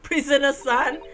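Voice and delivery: startled echo. Acted. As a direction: throw the title back in disbelief. Echoes the odd form of address.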